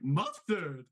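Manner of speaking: growled character mustard; clown voice. Retold or imitated. Whenever chat says mustard, he jumps in as Chuckles. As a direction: pounce on the word mustard in the clown's voice. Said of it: "I have to jump in, like".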